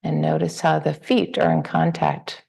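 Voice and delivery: unhurried meditation guidance with long pauses; guided meditation. Real, not acted. Teacher guiding the group to relax into the body, guided meditation. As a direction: ease listeners gently through a body scan, leaving space between phrases.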